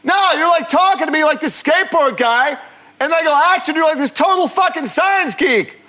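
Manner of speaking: high, loud mock yell from the director; loud, high mock director's yell; imitating a shouting director. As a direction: bark it across the set like an angry director. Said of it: "he's a shouter".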